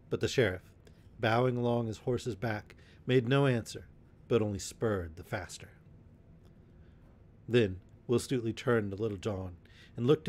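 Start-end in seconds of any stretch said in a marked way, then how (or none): none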